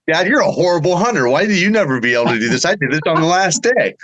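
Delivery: lightly voiced son gloating at his dad; bragging kid. Son teases dad about being a horrible hunter, light mock brag. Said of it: "typical kid".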